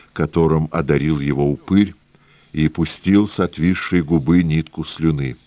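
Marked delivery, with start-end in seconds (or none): none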